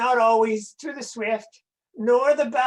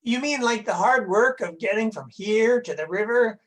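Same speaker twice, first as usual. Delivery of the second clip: ordinary talk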